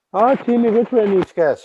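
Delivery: tiny cave woman calling out her thanks; little Memegwesikwe voice. Acted. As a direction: lift up high and bright into the little one's thanks. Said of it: "She said".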